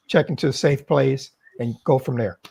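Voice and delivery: usual speaking voice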